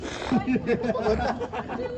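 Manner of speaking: soft chuckle; laugh. Real, not acted. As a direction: chuckle quietly at the play. Commentator laughs at a player.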